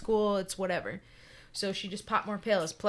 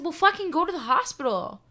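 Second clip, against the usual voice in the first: higher, louder exasperated order; exasperated yell; 9 semitones higher, 7 dB louder than usual. Retold or imitated. To his sick girlfriend on the phone, exasperated yell. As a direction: snap at her to just go. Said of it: "And I said".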